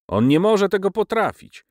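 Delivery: high-pitched, scornful brush-off; contemptuous dismissal. A narrator's reading. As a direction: dismiss him with lofty contempt. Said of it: "rzekłem z pogardliwym lekceważeniem".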